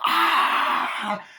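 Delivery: loud, high burst; long hammed-up scream; mock scream. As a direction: imitate a terrified shriek. Scream heard as killer appears.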